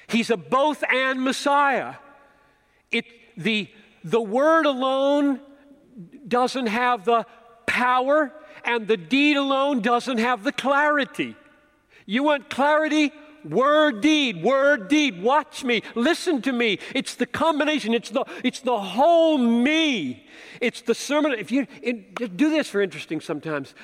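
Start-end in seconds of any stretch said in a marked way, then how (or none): 0.1-11.3 higher, louder, pounding sermon voice; impassioned preacher building to a peak; emphatic preaching. Acted. Preaching at full force. 12.0-15.2 WORD and DEED hammered high and loud; rhythmic emphatic chant of "word, deed"; punched words. Acted. Preacher pounding key words. 15.2-21.3 higher, louder, pounding sermon voice; impassioned preacher building to a peak; emphatic preaching. Acted. Preaching at full force.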